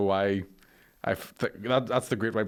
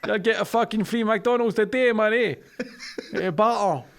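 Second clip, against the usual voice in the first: put-on Scottish accent; 2 semitones higher than usual. Continues Scottish bit.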